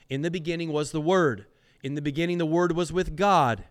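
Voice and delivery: fast, quick run through "In the beginning was the Word"; brisk recap. Real, not acted. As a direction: rattle it off as a reminder.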